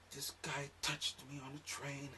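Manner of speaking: put-on high, soft girly voice; timid girlfriend. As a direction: girlfriend telling him what happened. Girlfriend reporting being groped.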